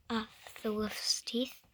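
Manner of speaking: ordinary delivery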